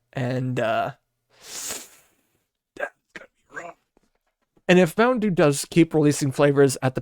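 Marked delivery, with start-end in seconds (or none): 2.8-3.8 breathy half-voiced mutter, fast; whispered aside. Real, not acted. Vlogger dreading expired Mountain Dew, whispered aside. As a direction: let it slip out under the breath.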